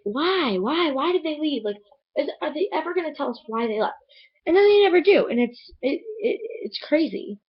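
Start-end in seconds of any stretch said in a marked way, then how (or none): 4.5-5.3 exasperated. Real, not acted. Frustrated rant.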